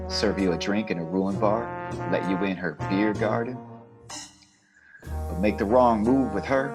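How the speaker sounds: ordinary talk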